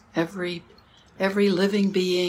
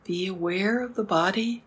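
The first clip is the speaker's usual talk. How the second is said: soft spa whisper; 7 dB quieter than usual. Acted. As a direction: ease them into stillness. Slow meditation cues.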